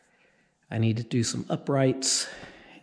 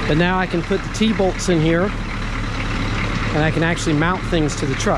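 shouting over machinery; 3 semitones higher than usual. Real, not acted. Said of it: "we're gonna shout over the engine".

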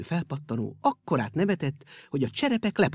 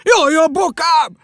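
loud, high, slow yelp; his ankle kicked by a shoe; pained cry; 11 semitones higher, 13 dB louder, slower than usual. Acted. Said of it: "nyögte".

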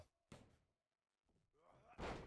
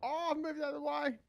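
much higher, louder amazed cry; surprised high; 11 semitones higher, 6 dB louder than usual. Real, not acted. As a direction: yelp it in disbelief. Surprised at own dodge in game.

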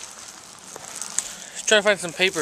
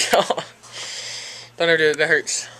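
ordinary talk, nothing marked

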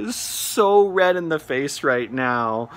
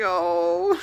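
amused squeal. Real, not acted. Squeal of amusement at his coyness.

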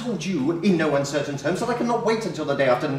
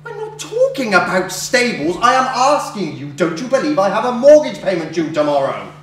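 loud, raised, insistent voice; exasperated arguing; 3 semitones higher, 5 dB louder than usual. Acted. Creditor brushing off her complaint about shouting, exasperated and loud. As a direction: push each phrase hard in exasperation. Heated quarrel over a debt.

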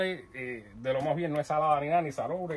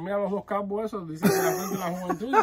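laughing; 4 semitones higher, 4 dB louder than usual. Pretend. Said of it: "Otra gente y dicen".

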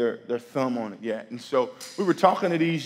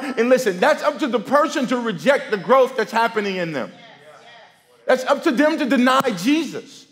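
raised, ringing preaching voice; 10 semitones higher, 9 dB louder than usual. Acted. Preacher exhorting the congregation not to fear rejection, full-voiced preaching. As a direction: drive the point home to the church.

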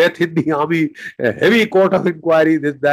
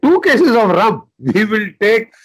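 eager villagers; 5 semitones higher than usual. Retold or imitated. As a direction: jump at the offer of rum. Villagers eagerly accept the rum.

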